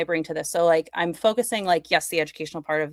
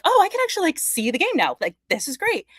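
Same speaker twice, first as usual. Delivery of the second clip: excited; 12 semitones higher than usual. Retold or imitated. Retelling her delight at the game.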